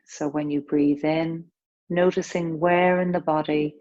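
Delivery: calm, unhurried breath-awareness guidance; yoga teacher easing the class into breathing; guided meditation. Acted. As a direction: gently guide their attention through each breath. Leads a breath awareness practice.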